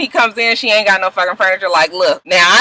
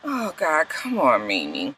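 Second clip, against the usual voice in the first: weary groan; 7 semitones lower, 11 dB quieter than usual. Real, not acted. Exasperated groan at Mimi.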